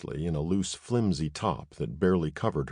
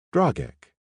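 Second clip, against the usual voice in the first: put-on "Dragek" said higher, tasting the strange name with a human accent; 11 semitones higher, 5 dB louder than usual. A narrator's reading. Woman repeats the alien's name, in her human accent. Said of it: "She shaped his name with her human accent".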